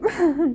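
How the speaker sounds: short laugh-sob; real emotion spilling over; tearful laugh. Real, not acted.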